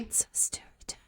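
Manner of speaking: hissy, eerie echo in a whisper; haunting whispered echo; creepy whisper. Acted. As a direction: whisper it like a voice from beyond. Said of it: "Also creepy".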